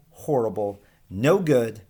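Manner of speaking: usual speaking voice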